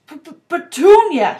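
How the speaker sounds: shocked stammer bursting out high and loud; horrified gasp. A narrator's reading. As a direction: stumble on the first sound, then burst out. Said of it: "he gasped".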